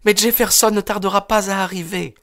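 very high, energetic daughter's voice; high-pitched, spirited girl; energetic young woman. A narrator's reading. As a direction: answer firmly in a high female voice.

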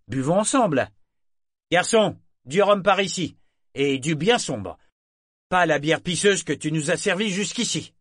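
voix de marin enjoué, plus haute et forte; Brachen jovial. A narrator's reading. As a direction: trinque en camarade rieur. Brachen joue le compagnon jovial. (marin chaleureux qui offre à boire)

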